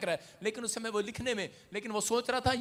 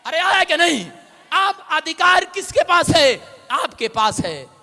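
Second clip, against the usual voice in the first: preaching; 2 semitones higher, 12 dB louder than usual. Acted. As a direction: preach it out to the congregation. Preaching at full force.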